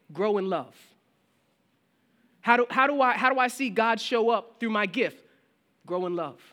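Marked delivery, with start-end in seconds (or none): 2.4-5.2 higher, louder asking church member; eager congregant. Retold or imitated. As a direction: play the keen believer asking the pastor. Pastor voices an asking congregant.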